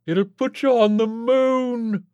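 high, loud, drawn-out boast; gleeful crowing. A narrator's reading. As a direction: crow it slowly and triumphantly. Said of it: "Orlin crowed".

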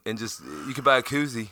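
normal talking voice

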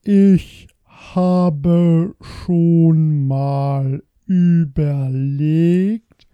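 words stretched out very slowly; very slow speech. Shown as a demonstration. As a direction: drag every word out slowly. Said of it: "und ganz langsam sprechen sollen".

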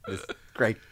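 usual speaking voice

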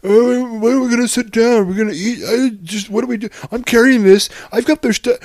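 high, loud mock whining; loud, high imitation of a nagging kid; whiny Ezra. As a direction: play the complaining kid, hammed-up. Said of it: "Ezra's in the background".

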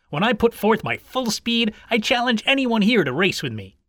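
high-pitched boastful hare; boasting. A narrator's reading. Hare challenging the other animals, boastful. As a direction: throw down a proud challenge. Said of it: "boasting of his speed".

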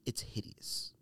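a breathy whispered verdict; whispered disgust. Real, not acted. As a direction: drop to a whisper to condemn it. Disgusted verdict on sneaker design.

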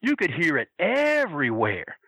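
usual speaking voice

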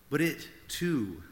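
normal talking voice

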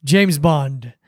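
low, lightly acted James Bond; measured self-introduction, pitched low, with pauses; a hint of suave spy introducing himself. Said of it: "introducing himself by, by saying".

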